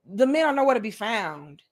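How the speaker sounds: ordinary talk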